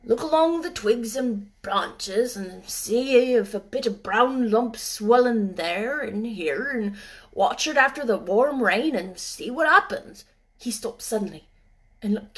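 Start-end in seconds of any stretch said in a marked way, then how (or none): 0.0-10.2 put-on slow gruff old gardener. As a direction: explain slowly as old gardener. Ben speaks.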